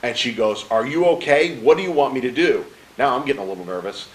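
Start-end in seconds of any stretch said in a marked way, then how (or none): 0.7-2.6 higher, louder worried questions; retold alarm, raised voice; alarmed wife. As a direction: imitate a wife jolted wide awake. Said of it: "she is up, she is alert".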